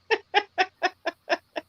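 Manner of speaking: high, even string of chuckles; light laugh. Real, not acted. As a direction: giggle lightly at a friendly comment. Laughs at the chat comment.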